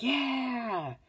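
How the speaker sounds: high, drawn-out cheer at normal volume; mock a cheering crowd, high and held; contained falsetto-like whoop; imitated crowd cheer.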